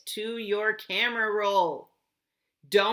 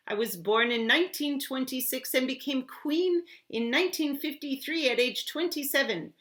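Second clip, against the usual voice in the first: put-on British accent as the Queen; 6 semitones higher than usual. Teacher playing the Queen in a British accent. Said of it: "try my best to do a British accent and be the queen's voice".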